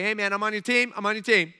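loud, insistent pledge of support; eager loyalty. Acted. Nehemiah assuring the king of loyalty, loud and eager. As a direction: push the flattery out loudly. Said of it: "he compliments him".

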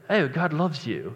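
a soothing English accent; soft English accent. Shown as a demonstration. Pastor to the congregation, in a soft English accent. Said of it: "an English accent".

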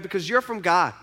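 ordinary talk

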